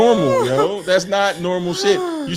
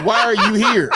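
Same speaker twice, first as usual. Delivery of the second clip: mock mom question; 4 semitones higher, 4 dB louder than usual. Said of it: "the first question is".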